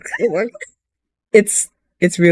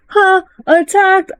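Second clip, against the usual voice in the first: much higher, startled "Huh? Attacked?"; startled disbelief; 12 semitones higher, slower than usual. Retold or imitated. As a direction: caught off guard, squeak it out. Character shocked by sudden attack.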